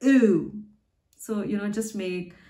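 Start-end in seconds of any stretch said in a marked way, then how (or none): 0.0-0.5 "oo" as a loud, long German ü; drawn-out front-rounded ü on "oo"; U umlaut. Shown as a demonstration. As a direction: say "oo" as ü, falling tone. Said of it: "that's pronounced as".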